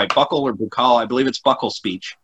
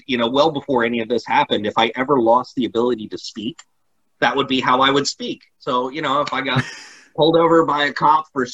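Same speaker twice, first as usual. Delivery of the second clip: normal talking voice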